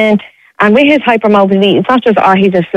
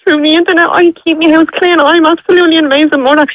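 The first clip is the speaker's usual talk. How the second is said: tearful; 6 semitones higher than usual. Real, not acted. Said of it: "I can hear the, the emotion".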